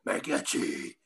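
dropped low, half-whispered name; a mock deep growl of the nickname; deep voice attempt. Shown as a demonstration. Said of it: "I'll try to say it really".

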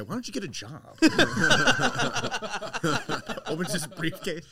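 laughing through the words; cracking up mid-sentence. Real, not acted. Laughing while recounting film scene.

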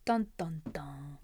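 spooky whispered sting; whispered dun dun dun. Pretend. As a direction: whisper a dramatic sound cue. Mock dramatic sting.

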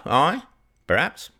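a hint of a blunt old farmer making his case; lightly voiced old villager. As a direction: argue like a plain-spoken countryman. Old Bob argues against priest.

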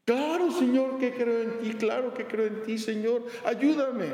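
high, breathless eager answer; eager desperate man. Acted. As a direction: grab at the voice with hope. Man pleads to God.

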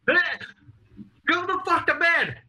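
very high exasperated mom voice; mock flustered mom. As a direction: brush him off high and flustered. Mom caught out, dismissing.

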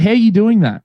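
imitating a baffled, insistent question; retold bafflement. Brother's repeated question acted out.

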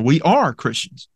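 ARE stressed with falling tune after it; emphatic ARE, the rest lighter. Shown as a demonstration. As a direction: repeat it firmly, leaning hard on "are". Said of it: "Emphasis original".

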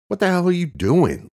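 high, loud startled yell; shouting. Acted. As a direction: yell as you get thrown sideways. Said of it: "Luke shouted".

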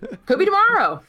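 high mocking sing-song shrug; mock doctor. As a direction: lift it way up, mocking the diagnosis.

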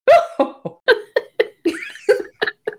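high rippling laugh; real amused laughter; high laughing fit. Real, not acted. Laughing at the romance line.

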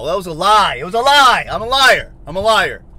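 loud, high, self-accusing shouts; screaming outburst. Real, not acted. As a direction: shout each phrase, swooping up high. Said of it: "you gotta listen to me scream".

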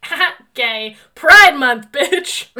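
loud, punchy sassy taunt; triumphant shout. Retold or imitated. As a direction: belt Pride Month at them. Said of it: "I'll be like".

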